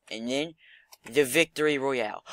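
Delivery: ordinary talk, nothing marked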